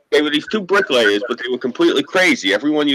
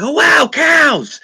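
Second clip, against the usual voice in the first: high, loud and slow with wonder; amazed; 7 dB louder, much slower than usual. Retold or imitated. Telling his friends in the car about cows, amazed and loud. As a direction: gasp it out like a kid seeing cows. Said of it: "and I'm like".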